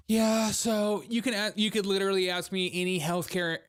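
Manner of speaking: hammed-up smug expert; smug doctor. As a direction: hold forth like a cocky doctor. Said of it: "every doctor I've ever met is just like".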